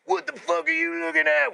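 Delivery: very high, strained imitation; teary mock challenge. Maced cellmate challenging him across the cells, mock tearful. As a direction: mimic a tearful drunk picking a fight. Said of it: "With all his tears coming out".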